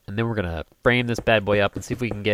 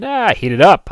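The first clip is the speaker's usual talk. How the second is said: high, loud mock confidence; cocky mock thought; 10 semitones higher, 12 dB louder than usual. Retelling his thoughts on warming the car, mock cocky.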